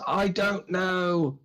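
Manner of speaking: slow, high, helpless answer; guard yelling back. Acted. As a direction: call back unsure. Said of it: "they're yelling back and forth".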